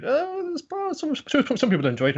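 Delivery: very high squeaky mascot voice; high cartoon critter tone; cutesy mascot. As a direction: sweetly defend the doll. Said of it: "Peggy Tan's like".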